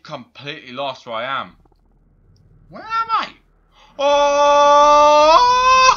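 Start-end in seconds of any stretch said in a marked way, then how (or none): 2.7-5.9 very high shrieking cry; terrified scream. Real, not acted. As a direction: scream it in terror. Scared while lost.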